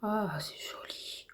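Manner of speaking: awed whisper. Real, not acted. Admiring a new eyeshadow shade.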